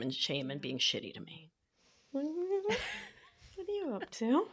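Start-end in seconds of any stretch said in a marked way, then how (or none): 2.1-4.5 very high, slow, soft giggling coo; lovingly, laughing. Shown as a demonstration. Cooing to herself, slow high giggly tenderness. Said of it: "I say it lovingly".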